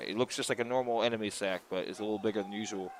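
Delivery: talking while munching popcorn. Real, not acted. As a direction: speak through a mouthful of popcorn. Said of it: "I'm eating popcorn while I play".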